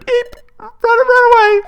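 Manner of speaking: very high, loud cartoon squeal; hammed-up tiny-voiced panic; panicked squeaky creature. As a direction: squeal as if fleeing in terror. Voicing frightened fleeing creature.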